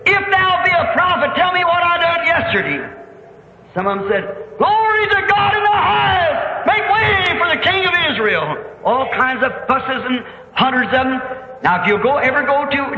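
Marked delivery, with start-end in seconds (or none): 0.1-2.8 high-pitched challenge from the crowd; raised, demanding call; heckling. Retold or imitated. Another heckler taunts the prophet. 4.6-8.6 very high, stretched-out shout of praise; exultant herald's call; jubilant hailing. Retold or imitated. Crowd hails the coming king.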